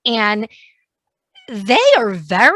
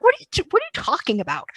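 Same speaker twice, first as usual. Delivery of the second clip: retold bafflement; 8 semitones higher, much faster than usual. Retold baffled reply to snooty friends.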